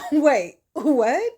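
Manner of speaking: high, loud, incredulous question; squawked mock surprise, rising at the end; acted bewilderment. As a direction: react in comic disbelief. Acts out Kendrick's bewildered reaction.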